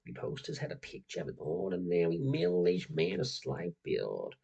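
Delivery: creepypasta read in a put-on American accent. Reading a creepypasta to his viewers in an American accent. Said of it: "this is my go-to American accent".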